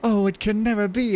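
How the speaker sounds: high, swooning mock-tragic line; melodramatic girlfriend. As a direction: sigh it like a lovelorn heroine, pitched high. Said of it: "she's just like".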